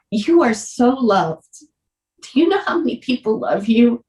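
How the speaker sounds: high, tearful voice close to crying; choked up. Real, not acted. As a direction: hold back tears while quoting tender words. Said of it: "I'm gonna cry".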